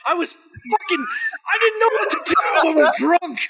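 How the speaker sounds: loud, high-pitched self-defense; exasperated outburst. Real, not acted. Defending himself as friends tease him, exasperated shouting. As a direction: shout the excuse, voice shooting way up. Protests after being told he panicked.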